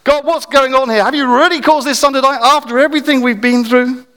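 high, loud desperate plea; anguished prayer. Retold or imitated. Prophet pleading with God over the dead child, loud and raw. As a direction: shout your question at God.

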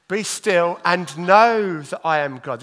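"know" hit loud and slow, the rest lighter; stress on know. Shown as a demonstration. Preacher's last try, leaning on "know", slow. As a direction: land firmly on "know", unhurried. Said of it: "This is my last one".

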